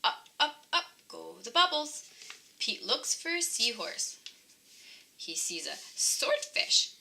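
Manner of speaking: ordinary delivery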